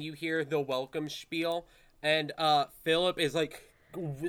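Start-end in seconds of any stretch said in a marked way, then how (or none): none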